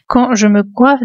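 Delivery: plain reading voice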